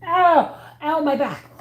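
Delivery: sharp, high yelp of pain. Real, not acted. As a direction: cry out from a sore back. Drops item, back hurts.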